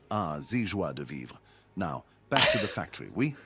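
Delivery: put-on terrible French accent. Bot signs off the request in a mock French accent. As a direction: lean into a cheesy French accent. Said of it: "speaking in a terrible French accent".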